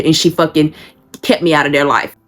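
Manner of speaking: normal talking voice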